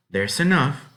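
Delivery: grudging wife. Acted. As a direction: allow it grudgingly.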